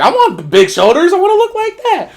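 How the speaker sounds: put-on high, loud little-boy voice; eager little kid. Little me wanting to look like the players, excited. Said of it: "little me's like".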